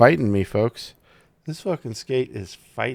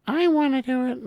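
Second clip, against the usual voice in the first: much higher mocking childish voice; mock whiny voice. Host mocking people obsessed with doing it themselves, whiny high voice. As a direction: whine it like an eager kid.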